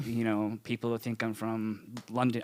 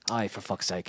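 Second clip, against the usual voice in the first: low, softer Irish "Aye, for fuck's sake"; Irish accent; 4 semitones lower, 5 dB quieter than usual. Shown as a demonstration. Actor tries the Irish phrase in Irish accent. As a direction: mutter it like an Irishman. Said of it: "you wanna give it to the Irish".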